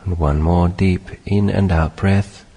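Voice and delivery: measured meditation guidance in short phrases; unhurried meditation teacher, spacious phrasing; guided meditation. Acted. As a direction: guide them gently through it, long pauses between phrases.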